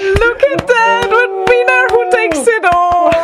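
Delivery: excited. Real, not acted. Cheering the repeat winner.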